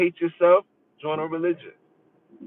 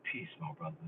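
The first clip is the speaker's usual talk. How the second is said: mock pious greeting; 22 dB quieter, faster than usual. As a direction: imitate the stiff brother greeting. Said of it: "it's like".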